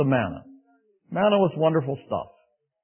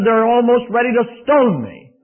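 louder, higher, slow plea; alarmed complaint; 8 semitones higher, 8 dB louder, slower than usual. Acted. Moses crying out to the Lord, louder and pleading. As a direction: sound desperate to God. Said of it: "Moses said".

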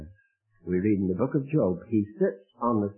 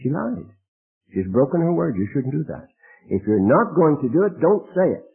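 louder, higher scolding; stern rebuke; 5 semitones higher, 5 dB louder than usual. Acted. Preacher scolding the mother who broke her word, louder and higher. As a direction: hammer each phrase home.

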